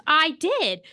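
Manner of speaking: high, loud retold protest; mock protest. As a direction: imitate someone defensively insisting. Harry protests he handled it, retold.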